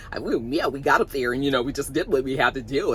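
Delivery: low, laid-back imitation of the gymnast; imitating gymnast. Vlogger imitating how the gymnast talks in interviews, low and relaxed. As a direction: drop the voice low and go easygoing like the young athlete. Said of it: "he talks like this".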